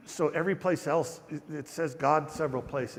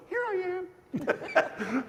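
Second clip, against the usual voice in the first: very high, very slow, giggly little voice; cute high answering voice with a laugh; popping up cheerfully; much slower than usual. Retold or imitated. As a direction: squeak it out like someone popping out of a bush. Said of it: "like he pops up from the bush".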